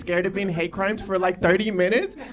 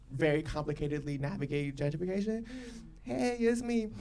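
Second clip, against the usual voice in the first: ordinary talk, nothing marked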